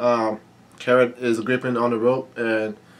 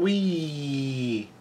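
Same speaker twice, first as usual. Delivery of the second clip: long drawn-out mock yell, kept soft; Big Mom; 3 semitones higher, 6 dB quieter than usual. Reader voicing a character's cry, soft drawn-out mock yell. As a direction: stretch the word out, softly sliding downward. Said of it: "Big Mom yells out".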